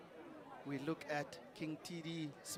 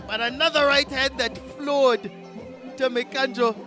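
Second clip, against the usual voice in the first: excited commentary; 5 semitones higher, 3 dB louder than usual. Real, not acted.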